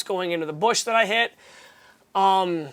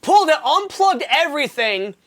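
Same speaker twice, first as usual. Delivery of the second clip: laughing; 6 semitones higher, 7 dB louder than usual. Real, not acted. Laughing while retelling.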